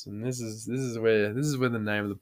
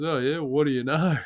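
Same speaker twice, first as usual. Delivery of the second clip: higher, singsong mock-casual line; imitated mate's catchphrase; 4 semitones higher, 4 dB louder than usual. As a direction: lightly put on the cheeky mate. Mimics mate's running catchphrase to cops.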